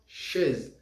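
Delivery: French "chaise", soft and falling. Shown as a demonstration. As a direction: say "chaise" as shez, dropping away quietly. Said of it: "Vamos repetir".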